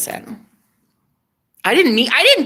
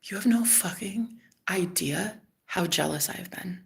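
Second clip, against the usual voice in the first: quiet confession; 3 semitones lower, 11 dB quieter than usual. Retold or imitated. Ex's confession retold.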